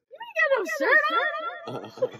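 high laughing voice. Real, not acted. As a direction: laugh through the words. Laughing at shirtless friend.

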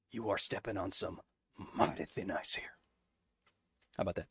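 low, quiet hint of a gruff survivor; lightly voiced Joel, low and soft. Shown as a demonstration. Asked by chat for Joel, lightly voiced and low. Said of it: "Can you do Joel from Last of Us?".

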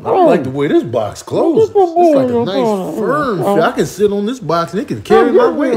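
high, loud, pleased cat voice; a high, delighted cat voice; cat loving a box. Acted.

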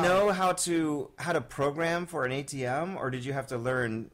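ordinary talk

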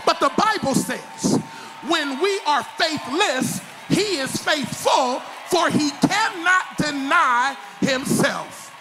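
high, loud, slow preacher's proclamation; full-force preaching. Acted. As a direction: thunder the scripture out slowly.

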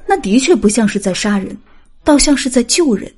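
usual speaking voice

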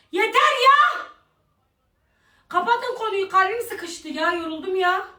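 high, fading into a soft tired whine. Real, not acted. Begging her friends to drop the subject, high exhausted whine. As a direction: plead high and worn out, trailing off.

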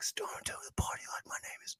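very soft, quick, unvoiced lyric; whispered singalong. Recalling singing along as the song comes on, whispered lyric. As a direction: whisper the line rapidly under your breath.

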